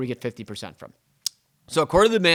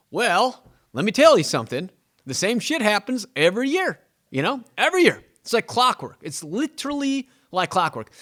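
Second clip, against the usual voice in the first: higher and louder, driving it home; emphatic insistence; 8 semitones higher, 5 dB louder than usual. Real, not acted. Realtor insisting to podcast listeners, high and emphatic. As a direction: hammer home that it happens every year. Insisting it happens every year.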